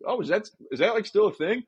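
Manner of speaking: lightly played, rising incredulous question; mock surprise. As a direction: act innocently surprised that the rule still exists. Retold mock-surprised question to woman.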